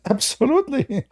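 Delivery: high, emphatic "Absolutely"; mock insistent reply. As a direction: play the sure, smug opponent. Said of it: "He said".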